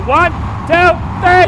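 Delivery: rising, building count to a shout; loud count-in. Real, not acted. Leader cueing everyone to yell, loud count-in. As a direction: count the crowd in at full voice.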